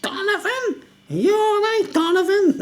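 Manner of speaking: high laughing squeaky mock voice; mocking weird guy. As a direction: squeal the name like a pest. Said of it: "all I could hear in the kitchen was".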